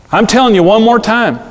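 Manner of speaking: high, mock-warning parent voice; exasperated parent. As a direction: imitate a parent making yet another threat. Mimics parent's repeated threat.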